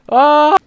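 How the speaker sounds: sudden loud high shriek; high scream. Real, not acted. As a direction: shriek in shock.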